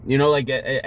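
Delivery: ordinary talk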